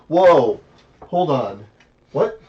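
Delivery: loud startled whoa; jolted, stop-right-there shout; shocked. Retold or imitated. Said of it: "I'm like".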